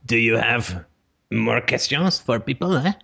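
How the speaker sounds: a hint of a French accent, slow and drawn out; mock French accent. Host teasing the callers in a hammed-up French accent. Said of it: "Do not make me speak in an outrageous French accent".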